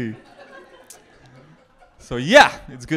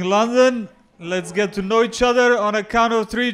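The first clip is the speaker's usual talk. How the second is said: ordinary talk, nothing marked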